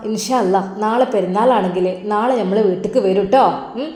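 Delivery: normal talking voice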